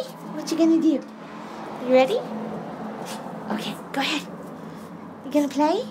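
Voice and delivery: high, cooing pet talk. Real, not acted. Coaxing her dog to hug mommy and play, high cooing baby talk.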